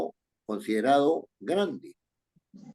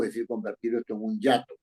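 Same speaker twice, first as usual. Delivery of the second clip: ordinary delivery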